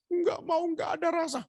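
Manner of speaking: high-pitched refusal; mock high wifely complaint; imitated sick wife. Husband voices sick wife refusing food.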